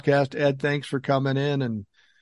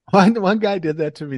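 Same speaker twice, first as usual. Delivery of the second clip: ordinary talk, nothing marked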